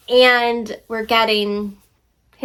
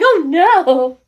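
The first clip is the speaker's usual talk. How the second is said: laughing mock dismay; 5 semitones higher, 5 dB louder than usual. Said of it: "You're like".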